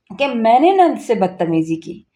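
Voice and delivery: ordinary delivery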